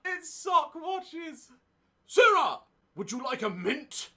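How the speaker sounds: high silly sock puppet voice. Pretend. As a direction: play a goofy sock puppet host. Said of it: "sock puppet take over".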